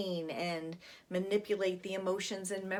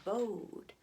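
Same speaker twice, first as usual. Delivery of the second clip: softer, dying echo of the name; fading echo; 2 semitones higher, 4 dB quieter than usual. Acted. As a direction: let the name fade into the well. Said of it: "we see an echo down the well".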